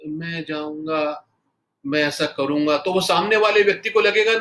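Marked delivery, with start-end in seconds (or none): 0.1-2.8 slow and soft. Shown as a demonstration. Trainer showing a soft speaker lacking fire, slow and gentle. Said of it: "आप धीरे धीरे से बोलेंगे".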